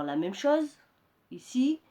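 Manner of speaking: usual speaking voice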